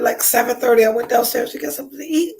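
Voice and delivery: normal talking voice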